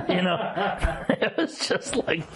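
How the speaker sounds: high laughing through the words; breaking into laughter mid-sentence. Real, not acted. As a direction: giggle while you say it. Laughing at conspiracy theorist attacks.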